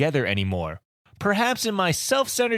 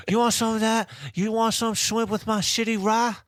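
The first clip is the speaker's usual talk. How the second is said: mock Chinese accent; 4 semitones higher than usual. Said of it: "making fun of South Park".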